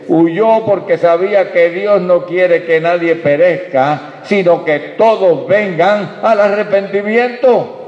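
fervent preaching. Acted. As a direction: drive the message home to the congregation. Preaching at full force.

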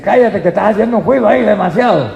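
high, loud, quick mock telling-off; acted scolding crowd. Crowd silencing the man who shouts, acted scolding. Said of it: "Y la gente le dice".

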